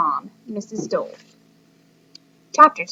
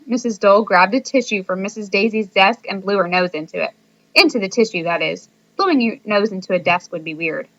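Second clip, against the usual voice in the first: usual speaking voice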